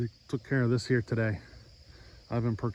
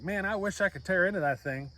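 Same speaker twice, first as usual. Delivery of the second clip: half-joking wonder climbing higher at the end; mock amazement; 6 semitones higher than usual. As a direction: play the builder delighted and baffled by his own saw. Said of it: "and you're like".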